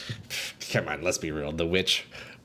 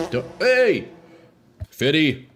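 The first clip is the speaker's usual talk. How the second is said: shout; 4 semitones higher, 4 dB louder, slower than usual. Real, not acted. Yelling at chat.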